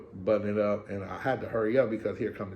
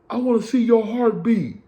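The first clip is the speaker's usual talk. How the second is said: mock guard; 12 semitones higher, 7 dB louder than usual. Guard's strip-search order.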